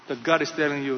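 usual speaking voice

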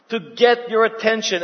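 ordinary talk

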